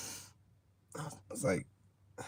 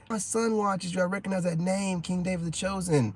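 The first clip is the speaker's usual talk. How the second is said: lightly raised excited fan voice; a hint of an excited woman; 7 semitones higher than usual. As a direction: gush at him lightly. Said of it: "it's just like".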